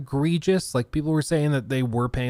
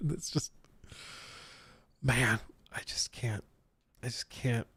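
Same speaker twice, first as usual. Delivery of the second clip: breathless whisper, giving up; hushed, can't go on; 2 semitones lower, 11 dB quieter than usual. Real, not acted. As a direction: whisper it as if defeated. Overcome, giving up.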